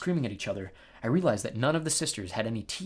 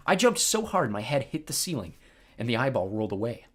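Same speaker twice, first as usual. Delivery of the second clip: usual speaking voice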